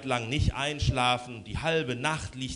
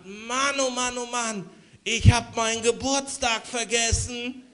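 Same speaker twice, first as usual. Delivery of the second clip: amazed; 8 semitones higher than usual. Acted. Lubo surprised by forgotten birthday.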